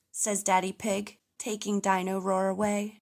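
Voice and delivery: ordinary delivery, nothing marked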